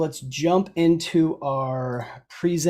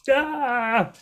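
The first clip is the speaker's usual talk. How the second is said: long, high exasperated "ah"; mock frustrated cry; 8 semitones higher than usual. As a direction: draw out a comic cry of overwhelm. Mock exasperation at last-minute questions.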